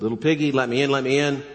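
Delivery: a hint of the big bad wolf at the door; wolf calling. Wolf at the straw house door, lightly voiced coaxing call. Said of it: "the big bad wolf came".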